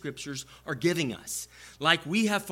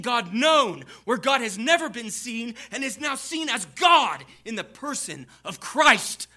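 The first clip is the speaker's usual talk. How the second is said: full-force preaching; 5 semitones higher than usual. Acted. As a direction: lift the room to the climax of the sermon. Preacher rises to a climax.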